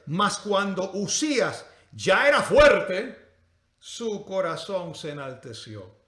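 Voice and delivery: slow, drawn-out verse with loud opening; dramatic scripture reading. Real, not acted. Preacher reading king Usías's downfall to church, dramatic scripture reading. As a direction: read the verse out slowly, weighting each phrase. Reads scripture aloud to congregation.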